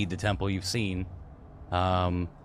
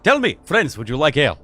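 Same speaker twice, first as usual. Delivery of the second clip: higher, louder innkeeper greeting that settles down; a hearty host's hello; jolly tavern keeper; 4 semitones higher, 6 dB louder than usual. Acted. Tavern keeper welcomes the small man, jolly. GM as Igor.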